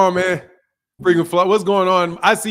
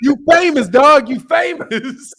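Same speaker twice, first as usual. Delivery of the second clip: high and laughing, stretched; laughing tease; 11 semitones higher, 5 dB louder, slower than usual. Real, not acted. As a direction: tease him through the laughter. Teasing caller, laughing.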